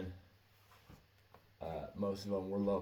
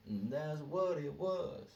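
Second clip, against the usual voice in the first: higher, slow what; slow high repeat; 7 semitones higher, slower than usual. Shown as a demonstration. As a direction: lean on what and rise.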